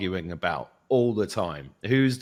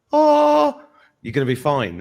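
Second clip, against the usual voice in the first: reassuring inner voice; 5 semitones higher, 6 dB louder, slower than usual. Retold or imitated.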